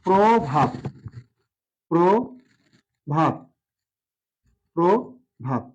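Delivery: প্রভাত said as probhat, first vowel o, drawn out; teacher models প্রভাত slowly with an o sound; pron of প্রভাত. Shown as a demonstration. Pron lesson: teacher to students, প্রভাত drawn out as probhat. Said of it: "কিন্তু উচ্চারণ হবে".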